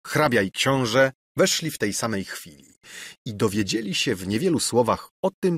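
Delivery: plain reading voice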